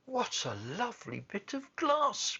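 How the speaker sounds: put-on very high, delighted child's voice; little girl's cry. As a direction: squeal it like a thrilled little girl. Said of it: "cried the little girl".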